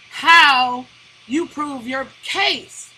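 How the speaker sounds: how shouted, then you prove your case drawn out slowly; slow, stressed point. Real, not acted. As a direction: hammer how, then spell out the rest slowly.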